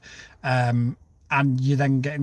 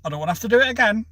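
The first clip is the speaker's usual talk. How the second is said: higher, fast, louder impatient interruption; exasperated cutting in; 7 semitones higher, 3 dB louder, faster than usual. Retold or imitated. Correcting the woman a second time, impatient. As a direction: cut her off, out of patience. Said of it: "I said".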